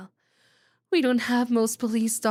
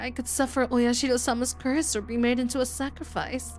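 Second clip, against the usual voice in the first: put-on higher, teasing girl savouring a curse; lightly voiced devilish schoolgirl; creepy Shion voice; 4 semitones higher than usual. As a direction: spook the listener with playful dread. Voicing Shion, eerie teasing.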